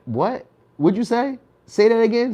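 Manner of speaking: a hammed-up what did you just say; baffled what. Listener reacts to a misused word, mock baffled.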